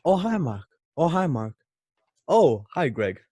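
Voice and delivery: cheery greeting. Retold or imitated. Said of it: "He's sitting down reading through scripts".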